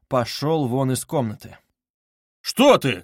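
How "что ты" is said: sharp, louder, higher cry; sudden raised-voice question; startled shout. A narrator's reading. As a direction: call out in alarm. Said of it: "крикнул Разумихин".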